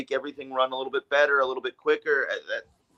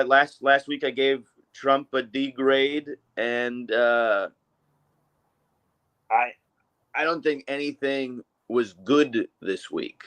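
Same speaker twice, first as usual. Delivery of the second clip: ordinary delivery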